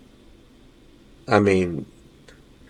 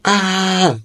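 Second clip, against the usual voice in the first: mild mock yell "Ah!", pitched high but no louder; retold yell; 10 semitones higher than usual. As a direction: cry out a short high "Ah!" as if waking from a nightmare. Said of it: "I just yelled really loud".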